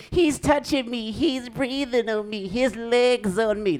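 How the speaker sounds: put-on high-pitched bickering child voice; drawn-out whine imitating kids squabbling in a car; whining kid. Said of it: "they don't wanna hear".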